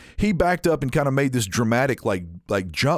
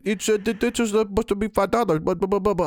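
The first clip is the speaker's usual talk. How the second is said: a hint of a fussy old lady, pitched higher; mock the complaining customer up high; 8 semitones higher than usual. Teller mimics the old lady fussing over the price, higher.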